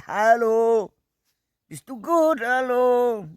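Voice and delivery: very high, loud put-on call; exaggerated high-pitched hailing voice. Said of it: "'Cause it's usually".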